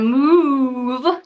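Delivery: long, loud "mooove" on MOVE; drawn-out pun. Shown as a demonstration. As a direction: MOVE stretched out like a cow's moo. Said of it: "see what I did there".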